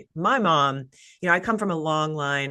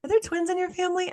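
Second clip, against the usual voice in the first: higher and quick, lightly voiced doctor; doctor's question; faster than usual. Doctor turning to the couple at the ultrasound, high quick question. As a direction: pop the question up high and brisk. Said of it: "the doctor turns to us and goes".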